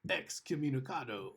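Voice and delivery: slow, badly faked accent impression; Ian McShane accent. Podcast host quotes a film line to co-host, terrible Ian McShane accent. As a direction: draw it out in a terrible put-on accent. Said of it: "that was a terrible Ian McShane accent".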